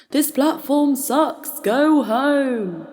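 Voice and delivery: high, loud, drawn-out shout; crying out over tannoy. Acted. Poet mimicking someone over the tannoy, crying out. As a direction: bellow it slowly like an announcement. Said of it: "over the tannoy crying".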